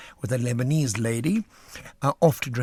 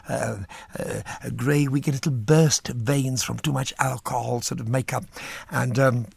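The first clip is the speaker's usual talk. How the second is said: ordinary talk, nothing marked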